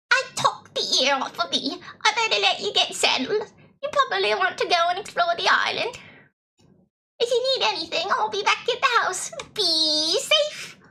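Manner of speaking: high, swooping British granny voice; British grandma. Acted. Grandma fussing over her returning grandchild, high British granny voice. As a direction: play a fussing British grandmother, pitched well up. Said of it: "why the grandma is British".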